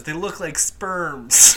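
loud, slow comic movie quote; hammed-up slow delivery; drawn-out quote. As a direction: stretch it out slowly and loudly for the laugh. Quoting a film line comically.

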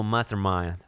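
mastermind with English pronunciation; english accent in italian. Shown as a demonstration. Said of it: "devi fare un po' così".

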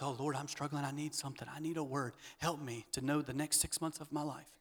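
soft, pleading plea to God; quiet, worn-out praying; hushed prayer. Retold or imitated. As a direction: murmur it like a desperate private prayer. Voicing a struggling believer's prayer.

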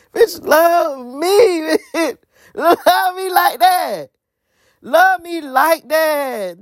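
high, slow wailed plea; mock pleading. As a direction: beg for love with drawn-out, half-laughing mock sobs.

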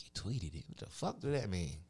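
normal talking voice

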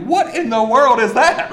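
loud, high, laughing disbelief; laughing amazement. Retold or imitated. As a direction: marvel at it through a laugh. Amazed at villagers wanting baptism.

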